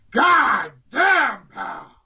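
swooping high growled curse, then dropping away; drawn-out disgusted boss impression; Vince impression. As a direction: curse like an exasperated boss backstage. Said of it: "quite a good Vince impression".

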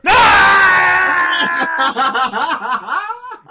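very loud, high drawn-out "no" wail; long mock scream. Reacting to being recognised on the phone, long comic scream. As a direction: scream it out, breaking into laughter.

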